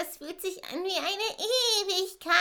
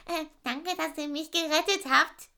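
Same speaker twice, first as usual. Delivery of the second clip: ordinary talk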